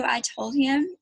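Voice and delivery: ordinary delivery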